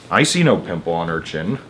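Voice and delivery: a hint of a sneering old aunt; sour Aunt Spiker. Thin aunt mocking her sister, dry and low.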